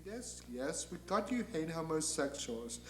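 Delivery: very soft, placating angel; hushed, weary patience; Gabriel. Acted. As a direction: humour the ranting boss quietly. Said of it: "And Gabriel says".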